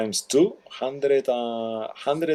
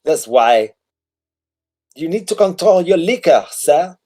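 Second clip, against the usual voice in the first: high, haughty nobleman; high haughty noble scolding War Turtle; sniffy husband; 8 semitones higher, 4 dB louder than usual. Acted. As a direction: look down on the drunk. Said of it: "he says".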